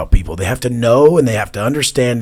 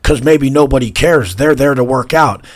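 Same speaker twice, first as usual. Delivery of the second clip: mock critic; 5 semitones higher, 8 dB louder than usual. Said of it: "they'll be like".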